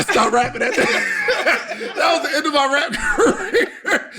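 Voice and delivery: high, drawn-out words through laughter; laughing hard. Real, not acted. Guest joking about the end of a rap career, real high laughing delivery. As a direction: crack up while protesting.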